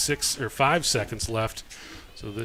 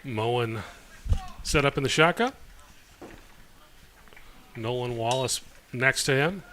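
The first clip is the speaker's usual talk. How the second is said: ordinary talk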